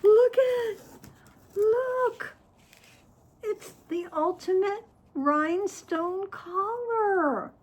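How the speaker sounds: high, loud, near-tearful delight; thrilled squeal. Real, not acted. Showing viewers the rhinestone collar in thrilled, high delight. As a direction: gush as if about to cry with joy.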